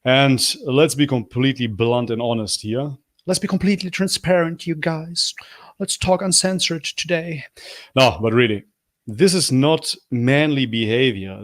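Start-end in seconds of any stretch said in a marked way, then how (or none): 3.2-7.4 hushed conspiratorial whisper. Acted. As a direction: lean in and whisper it like a secret. Conspiratorial aside.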